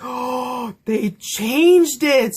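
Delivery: drawn-out, slow gasp of disbelief; shocked reaction. Retold or imitated. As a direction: re-enact a scandalized fan, stretching the words. Re-enacts her shock at plot change.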